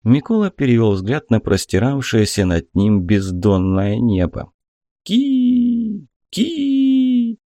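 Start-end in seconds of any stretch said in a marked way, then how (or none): 5.0-7.4 high piercing bird cry from the sky. A narrator's reading. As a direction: cry out like a hawk. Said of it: "донеслось с высоты".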